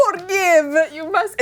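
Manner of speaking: playful imitation of his Spanish accent; broken English. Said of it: "In his broken English".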